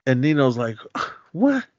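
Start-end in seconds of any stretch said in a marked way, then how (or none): 1.3-1.6 incredulous what. Retold or imitated. Nino incredulous at G Money.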